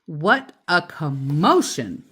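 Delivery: usual speaking voice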